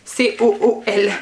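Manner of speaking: slowly spelling C O O L out letter by letter in a French take on English; English accent. Shown as a demonstration. Vlogger spelling the shade name for viewers, in a slow English accent. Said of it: "mon, mon accent, euh, anglais".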